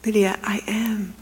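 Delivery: slow, tender whisper. Retold or imitated. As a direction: confide it slowly. Secret talk about the Bible.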